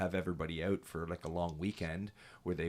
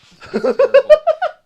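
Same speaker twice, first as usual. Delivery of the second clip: high burst of laughter; loud laugh; 25 dB louder than usual. Real, not acted. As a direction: crack up loudly.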